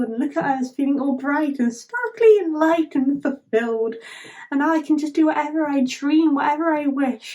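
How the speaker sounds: high, gushing put-on happiness; mock happy cheer. Everyone applauding the defeated king's end, mock joy. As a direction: gush it like a gleeful crowd, pitch lifted. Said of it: "happy stuff".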